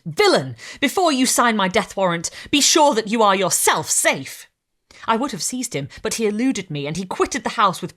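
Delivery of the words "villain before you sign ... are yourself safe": loud warning cry; exclaiming. Acted. Victor warning the departing creature, loud exclaim. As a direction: shout the warning after him.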